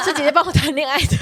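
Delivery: loud, high, laughing through the words. Real, not acted. Telling friends sister did the romancing, laughing. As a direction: crack up while admitting it.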